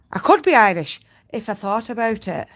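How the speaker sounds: a light Irish-Scottish lilt, playful; Irish accent. Pretend. Spinner teasing her chat with a mixed Irish accent. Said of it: "I went a bit, a bit Irish and Scottish then".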